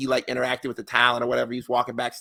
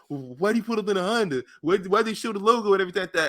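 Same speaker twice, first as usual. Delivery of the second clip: imitating confused fans; 7 semitones higher than usual. Imitating people online.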